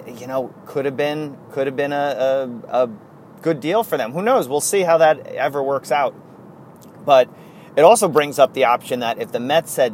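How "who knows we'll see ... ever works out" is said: ordinary talk, nothing marked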